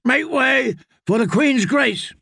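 high, slow, drawn-out shout; shouting knight. Acted. Knight clearing the way for the Queen's litter, shouting. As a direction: call it out slowly over the street. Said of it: "Sir Osmond Kettleblack was shouting".

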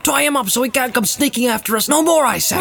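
high, insistent servant voice; indignant Sam. Acted. Sam urging his master to tie up the captive, high indignant voice. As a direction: insist on it, pitched well up. Sam urges tying Gollum up.

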